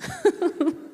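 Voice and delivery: a high bright burst of laughter; high real laugh. Real, not acted. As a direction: laugh at your own joke. Laughs at own joke.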